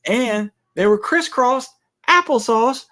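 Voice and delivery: high, playful rhyming singsong. Pretend. Teasing partner about mixed-up peppers, rhyming singsong. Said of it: "I was just rhyming".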